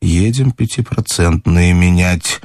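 plain reading voice

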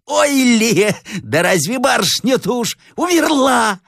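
high, cackling village madwoman; slow, high, crazed croak; hoarse madwoman. Acted. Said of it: "сказала охриплым голосом". (полоумная, охриплый высокий голос)

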